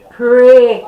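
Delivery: a sudden loud one-word command; commanding call. Retold or imitated. Preacher retelling God's command, called out loud. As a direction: boom out God's order to pray.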